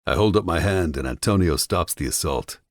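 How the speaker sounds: usual speaking voice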